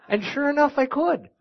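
put-on Minnesota accent. Guest preacher showing the church how Minnesotans talk, high Minnesota accent. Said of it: "I heard a feller talking like this".

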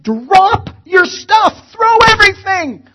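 slow, very loud high shout; yelling. Retold or imitated. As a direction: bellow the order while running. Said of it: "I just yelled".

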